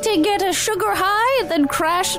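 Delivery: high, sing-song pitch to the customers; hammy ad read. Acted. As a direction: sell it like an eccentric crone baker. Performs an improvised bakery ad.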